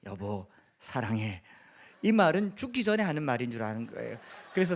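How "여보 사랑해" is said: low, slow deathbed confession; dying Gyeongsang pastor. Acted. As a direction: drag it out low, as if near death. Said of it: "죽을 때나 되어서야 사모님에게". (임종 앞둔 목사처럼 낮고 느리게)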